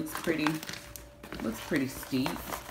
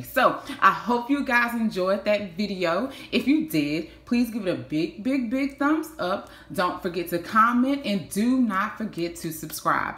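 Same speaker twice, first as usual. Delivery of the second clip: upbeat louder sign-off asking for likes; youtube outro; 5 semitones higher, 3 dB louder than usual. Acted. Closing her vlog to viewers, bright louder outro. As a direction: pump up the outro for subscribers.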